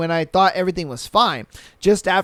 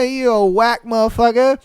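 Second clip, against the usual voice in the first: normal talking voice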